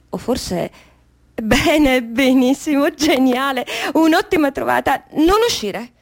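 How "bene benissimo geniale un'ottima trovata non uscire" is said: loud, mounting excitement; elated self-congratulation. Acted. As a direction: exclaim it as a brilliant find. Excited at the idea of staying. (esultanza ad alta voce)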